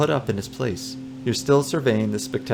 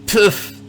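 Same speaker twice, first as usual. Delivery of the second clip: dismissive puff; 11 semitones higher, 7 dB louder than usual. Acted. Old man's dismissive puff.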